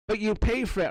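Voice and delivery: lightly voiced cop; mock officer. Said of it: "And like".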